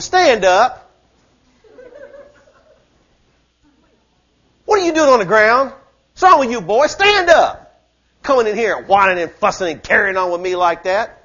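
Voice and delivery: loud, sharp rebuke of a cowering man; stern God. Acted. God rebuking Joshua on the ground, loud and stern. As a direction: scold him to his feet. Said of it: "The Lord said to Joshua".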